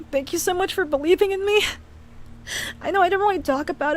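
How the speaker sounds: quick, tearful gratitude; real tears, thanking a friend; teary thanks. Real, not acted. Streamer tearfully thanking Josh for believing in him. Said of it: "crying like this".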